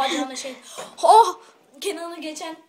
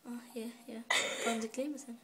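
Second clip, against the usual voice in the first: whisper; 2 semitones lower, 8 dB quieter than usual. Real, not acted. Whispered taunt.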